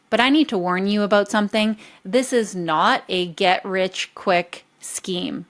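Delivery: ordinary talk, nothing marked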